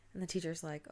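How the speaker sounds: ordinary delivery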